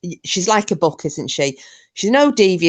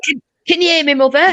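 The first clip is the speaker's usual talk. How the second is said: high, loud put-on call; imitating Nuff; 9 semitones higher, 5 dB louder than usual. Friend echoes the impression, calling loudly. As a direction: join in, calling higher still.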